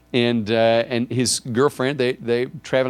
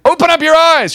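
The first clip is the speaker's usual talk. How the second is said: high, full-volume shout; booming command; 12 dB louder than usual. Acted. God ordering a reluctant believer, shouted command.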